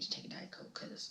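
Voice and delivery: real whisper through held-back tears; barely voiced, fighting not to cry. Real, not acted. Grieving mother whispering to viewers before the hard part.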